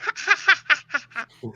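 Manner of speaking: high cackling laugh; witch cackle. Pretend. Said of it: "see, I'm like".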